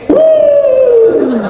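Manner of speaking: high pitying groan; a high, drawn-out groan of pity; mother's pitying sigh. Retold or imitated. Mother visiting her son, a high pitying groan.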